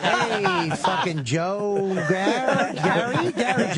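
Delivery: hammed-up greeting, stumbling over the name; fake friendly greeting.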